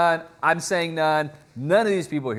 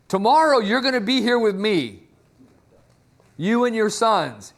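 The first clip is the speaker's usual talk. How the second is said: acted doom pronouncement; 5 semitones higher, 3 dB louder than usual. Samuel pronounces Saul's doom.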